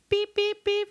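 high, loud imitated alarm; alarm beeps. Imagining someone's alarm going off in the morning, imitated beeping. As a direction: beep like an alarm clock.

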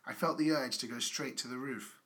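low, measured reading aloud; letter reading. Acted. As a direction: read a solemn confessional letter, deadpan. Reads out a letter.

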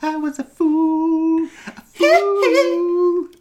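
very high, loud impression; [name]. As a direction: squeal it as the pop star.

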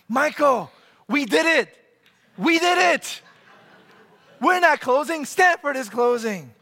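loud, high-pitched jubilant shout; celebrating. Retold or imitated. Dwight tells Michael the branch is saved, celebrating loudly. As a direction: whoop out the good news. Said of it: "he celebrates by saying".

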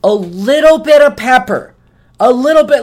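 high, loud prissy customer; mock fussy customer. Mocking the picky customer to the sandwich artist, high fussy voice. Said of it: "judge or not judge".

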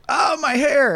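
high mock wail; bystander cry. Bystander hit by diapers, high wail. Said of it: "you hear someone go".

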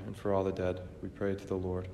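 ordinary talk

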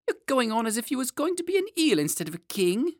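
put-on high, eager old nurse calling the boy; a fussy nurse fetching her charge, pitched high.

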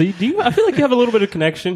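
teasing laugh. Real, not acted.

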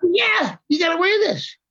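high-pitched imitation of a woman insisting; insistent woman. Woman tells the actor he must wear it, mimicked high and insistent. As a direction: put on a high, matter-of-fact woman's voice. Woman answering his protest, acted out.

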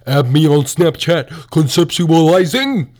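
loud, high mock promo voice; hammed-up social media plug; announcer plug. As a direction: sell it like a cheesy ad. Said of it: "That's the Midway plugs".

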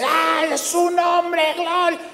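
high, slow shouted praise cutting in; congregation shouting back. Retold or imitated. Worshippers interrupting the reading with a high shout. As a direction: drown out the preacher with praise. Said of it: "en el Salmo ochenta y nueve quince".

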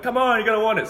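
hammed-up congregation coaxing; mock churchgoers. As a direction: coax it up high. Churchgoers urging.

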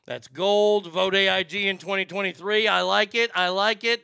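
ordinary talk

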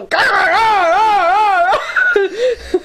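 strained open-mouthed gah ah ah, pitched high; choked, high gagging groans mid-cleaning; pained dental groans. Retold or imitated. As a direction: mimic a patient groaning as the hygienist yanks her cheeks. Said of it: "she'd pull my cheeks real hard and just go".

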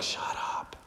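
whispered shut up; inner voice hushing. Acted. Inner voice silencing the need, whispered. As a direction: hiss it like a secret. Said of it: "this other part's like".

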